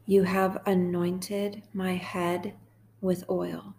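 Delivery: slow, measured psalm reading with long pauses; guided meditation. Acted. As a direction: read it line by line, unhurried, leaving room to repeat.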